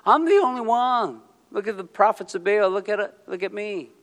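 high, loud boastful whine; mock Elijah's look-at-me pride; whiny self-pity. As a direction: Elijah mocked, whining look at me. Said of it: "operating out of disappointment, like".

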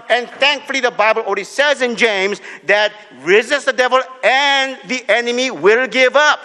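loud, high, driving preacher's call; preaching at full force. Acted. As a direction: preach it out to the congregation.

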